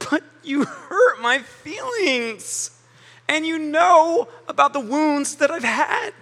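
mock wounded plea, drawn out; hurt whine. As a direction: whimper it like someone nursing old wounds. Voicing a hurt, self-pitying person.

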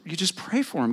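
usual speaking voice

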